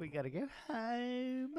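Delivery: high slow mock whine with a laugh; girl's whine. Mocking the girl's way of saying home, laughing whine. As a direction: stretch out home like the girl. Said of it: "imitating girl's "home".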